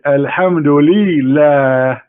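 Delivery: "Alhamdulillah" stretched out very slowly. Shown as a demonstration. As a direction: lay out "Alhamdulillah" sound by sound. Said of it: "And then he says".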